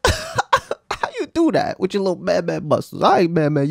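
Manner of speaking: high, laughing mockery; laughing through it. Real, not acted. Host laughing at the wall climber, laughing while mocking. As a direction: cackle the words out.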